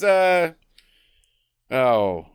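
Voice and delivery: ordinary delivery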